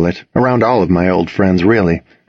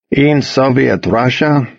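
slow, hammed-up bad Russian accent; a lightly done joke Russian accent; terrible Russian accent; 4 semitones higher, slower than usual. Joking with friends in the car, slow bad Russian accent. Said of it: "doing a terrible accent".

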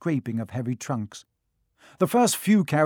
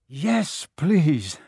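panting; 2 semitones higher, 4 dB louder than usual. A narrator's reading. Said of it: "Harry panted".

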